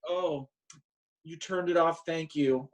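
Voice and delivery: high, unbothered thanks; lightly voiced careless mom; casual mom. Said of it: "she's like".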